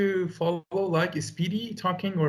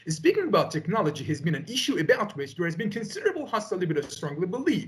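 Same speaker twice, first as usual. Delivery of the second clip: fluent recitation with clearer, livelier intonation; measured, with intonation; faster than usual. Shown as a demonstration. Student showing the tutor the improved delivery, less rushed with intonation. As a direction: let the phrases rise and fall, without rushing. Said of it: "This way I can have intonation".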